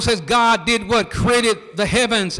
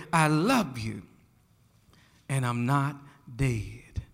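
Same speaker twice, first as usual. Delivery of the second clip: much softer and lower, almost breathed out, dropping away on "dead"; hushed refrain; 10 semitones lower, 10 dB quieter than usual. Acted. Preacher hushing the congregation, soft lowered refrain. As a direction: let it fall to a hush.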